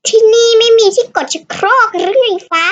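exasperated outburst. Real, not acted. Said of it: "ที่พูดคำหยาบ".

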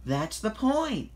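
high, loud, drawn-out exclamation; exclaiming. A narrator's reading. Thomas rousing sleepy Emily, exclaiming. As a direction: proclaim it high and emphatically. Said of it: "exclaimed Thomas".

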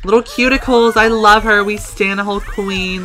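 high, excited fawning; adoring gushing. Real, not acted. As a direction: gush over your favourite character. Gushing over beloved Sim.

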